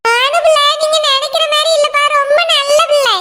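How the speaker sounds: ordinary talk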